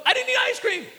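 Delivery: put-on high, loud kid's yelp, rushed; little boy's rushed denial. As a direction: blurt it out fast like a panicked toddler. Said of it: "It's so fast I couldn't even barely hear it".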